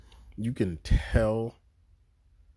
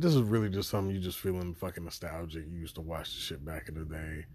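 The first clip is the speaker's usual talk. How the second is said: ordinary delivery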